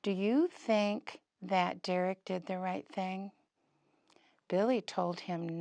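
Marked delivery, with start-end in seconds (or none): none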